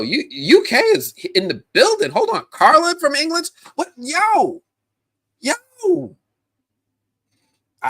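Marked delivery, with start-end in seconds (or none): 0.0-6.2 high, loud hyped shouting; thrilled, high-pitched hype; excited amazement. Real, not acted. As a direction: whoop in amazement at the new arrivals. Amazed at viewers from England.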